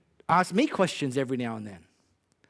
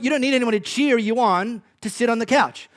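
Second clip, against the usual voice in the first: ordinary talk, nothing marked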